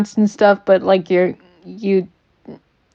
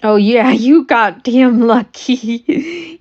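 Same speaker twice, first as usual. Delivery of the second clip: laughing; 2 semitones higher, slower than usual. Real, not acted. Player teased over lucky purchase.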